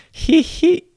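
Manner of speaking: very high nasal-free titter, sneering; high mocking giggle "he he he". A narrator's reading. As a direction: cackle up high at the brats. Nero giggling.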